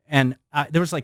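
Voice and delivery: ordinary talk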